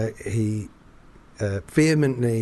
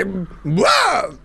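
garbled love; 7 semitones higher, 4 dB louder than usual. Retold or imitated. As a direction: yelp it, mangled. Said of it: "he would say".

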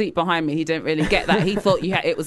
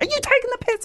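snapping; faster than usual. Retold or imitated. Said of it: "finally I was like".

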